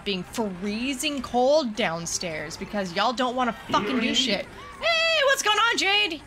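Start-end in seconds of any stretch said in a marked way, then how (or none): 4.8-6.2 cheery greeting. Real, not acted. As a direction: call out to a newcomer, pitch way up. Greets viewer arriving mid-rant.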